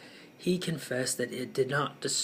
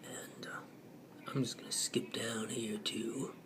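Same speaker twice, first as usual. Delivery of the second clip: whispered aside; 11 dB quieter than usual. Real, not acted. Muttered aside while skimming.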